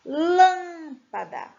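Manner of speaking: "Lâmpada" with LÂM long, high and loud; slow "Lâmpada", big stretched LÂM; stress on LÂM. Shown as a demonstration. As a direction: punch and hold the first syllable of "Lâmpada". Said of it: "é a sílaba tônica".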